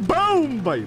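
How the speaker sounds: high, loud victory cry; triumphant shout. Real, not acted. Gamer celebrating a hit, triumphant shout. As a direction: punch out the first word loud and high.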